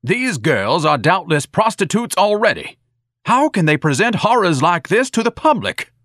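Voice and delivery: loud, high-pitched pompous outrage; indignant shouting. A narrator's reading. Ignatius shouting from the parlor about horrors, outraged. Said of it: "a voice shouted from the parlor".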